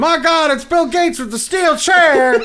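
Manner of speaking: high, loud, drawn-out announcer cry; mock frantic commentator. Commentator sees Bill Gates with steel chair, mock frantic shout. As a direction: call it like a wrestling shock moment.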